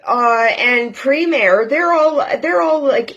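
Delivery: usual speaking voice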